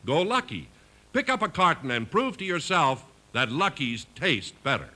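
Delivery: normal talking voice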